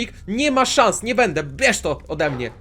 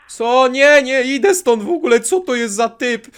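higher, louder panicky no; frightened refusal; 6 semitones higher, 8 dB louder than usual. Real, not acted. Gamer fleeing an axe-wielding stranger, panicky. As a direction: back away in alarm.

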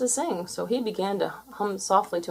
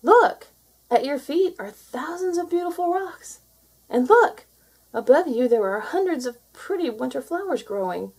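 excited calling seal; 7 semitones higher than usual. Acted. Seal shouting to creature up the beach, excited. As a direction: call out with delight. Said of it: "shouted to Mutsock".

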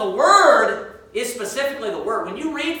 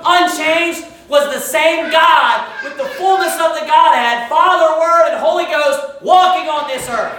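raised, louder preacher's voice; full-force preaching; 4 semitones higher, 4 dB louder than usual. Acted. Preacher declaring the Godhead to his congregation, forceful. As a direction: proclaim it with rising force.

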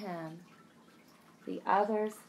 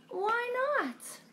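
innocent question. Acted. Wilbur asks innocently.

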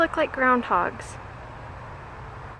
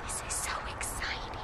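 thrilled but fully whispered; bubbling excitement kept under your breath; excited whisper; 15 dB quieter than usual. Real, not acted. Mother whispering her excitement about the wild animals. Hushed while watching animals.

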